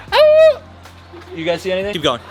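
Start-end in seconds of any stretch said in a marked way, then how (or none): 0.1-0.6 high loud howling call; wolf call. Acted. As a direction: howl out to the wolves. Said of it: "try to call to a wolf".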